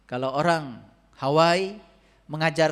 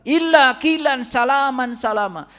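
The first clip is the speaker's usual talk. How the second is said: higher, louder, slow chanted recital; Quran recitation; 7 semitones higher, 6 dB louder than usual. Acted. Preacher to congregation, quoting the Quran in a slow chanted recital. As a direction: intone the verse like a reciter.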